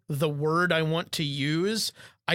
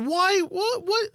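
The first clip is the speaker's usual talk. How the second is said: shocked disbelief; 12 semitones higher than usual. Real, not acted. Shocked at the picture.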